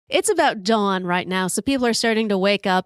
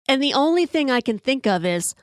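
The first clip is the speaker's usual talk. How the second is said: ordinary talk, nothing marked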